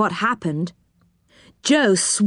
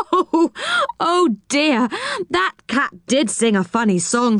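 laughing; 6 semitones higher than usual. Acted. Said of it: "wiping his eyes".

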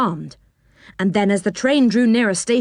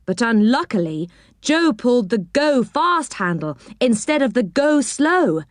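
plain reading voice

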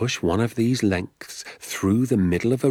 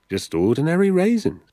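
matter-of-fact; 8 semitones higher, 3 dB louder than usual. Acted. Father repeats the word.

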